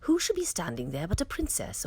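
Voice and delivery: usual speaking voice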